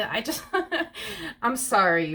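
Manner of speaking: breaking up into high laughter; helpless laugh breaking through the words; real laughing. Real, not acted. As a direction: crack up mid-sentence, then apologize. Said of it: "I can't help but to freaking laugh".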